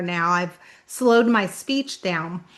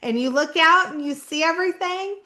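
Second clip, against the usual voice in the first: higher-pitched retold explanation in Southern accent; local Southerners; 7 semitones higher than usual. Locals explaining the fire tower to a newcomer, insistent Southern voice. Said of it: "And they would say".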